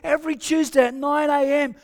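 fired-up church announcements; rally them with big energy; preaching. Real, not acted. Preaching announcements at full force.

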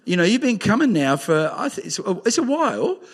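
ordinary delivery

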